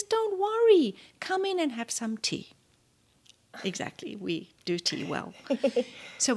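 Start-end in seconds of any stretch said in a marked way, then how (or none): 0.0-2.5 much higher and louder, kindly put-on voice; Mandela reassuring. Mandela inviting the flustered guest in for tea, high kindly voice. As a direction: soothe her like a gracious old host. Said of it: "He says".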